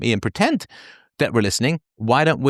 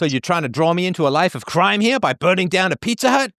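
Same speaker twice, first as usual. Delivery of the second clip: mock incredulous question; 7 semitones higher than usual. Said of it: "acted confused listener".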